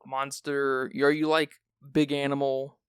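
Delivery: usual speaking voice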